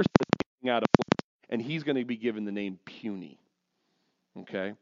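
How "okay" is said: normal talking voice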